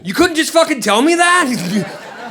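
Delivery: high, loud, drawn-out outburst; exasperated yell. Retold or imitated. Comedian to a cop over pointless paperwork, exasperated yell. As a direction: yell it slowly in disbelief.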